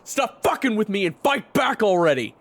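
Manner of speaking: high, loud frustrated yell; angry shout. Acted. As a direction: shout it at an opponent holding back. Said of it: "He grunted angrily".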